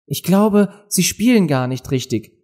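hell und klagend, wie ein junges Mädchen; klagendes Mädchen. A narrator's reading. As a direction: beklage dich mit heller Stimme. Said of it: "beklagte sich Alice". (Alice, leicht klagend)

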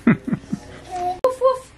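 high little dog woofs. Shown as a demonstration. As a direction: woof like a small dog. Making dog sounds for toddler.

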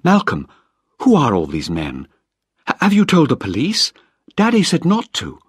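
Penny panicking as cars of men arrive; alarmed young woman. Acted.

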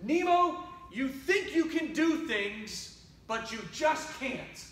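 acted exasperated father setting his son straight; scolding dad. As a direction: reprimand the child, starting high and emphatic. Dad scolds Nemo in the story.

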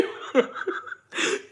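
breathless silent laughter; silent wheeze laugh. Real, not acted. Laughing after the meme.

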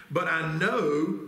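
slow the line and underline "know"; stress on know. Shown as a demonstration. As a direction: lean on "know", slow and rising. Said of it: "underline that word".

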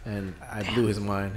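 normal talking voice

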